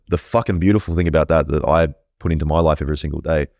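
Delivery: usual speaking voice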